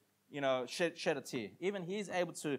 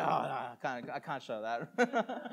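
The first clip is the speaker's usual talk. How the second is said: laughing self talk; 2 semitones lower, slower than usual. Retold or imitated. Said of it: "And then I was like".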